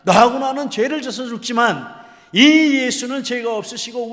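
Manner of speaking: very loud, high-pitched furious rebuke; scolding shout. Retold or imitated. Dying robber rebuking the other robber, scolding shout. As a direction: bellow a reprimand at a scoffer. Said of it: "이렇게 막 꾸짖습니다".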